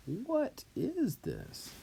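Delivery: high, slow, stretched-out incredulous question; emphatic disbelief. Shown as a demonstration. Teacher translating quid nam, emphatic disbelief. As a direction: draw it out in amazement. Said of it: "it just adds more emphasis".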